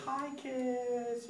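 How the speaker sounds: put-on high, soft girl's greeting; sweet hostess. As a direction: imitate a girl cooing hello to children. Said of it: "And then she goes".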